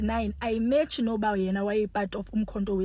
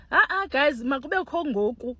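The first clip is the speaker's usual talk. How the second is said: ordinary talk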